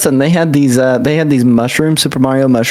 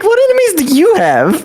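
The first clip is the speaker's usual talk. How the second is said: high, incredulous mock question; mock baffled question; 14 semitones higher than usual. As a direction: act amazed that she has enemies. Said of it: "And I'm like".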